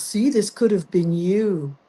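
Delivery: slow, lightly voiced smug taunt; a hint of a gloating ex-producer, slow. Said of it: "And he goes".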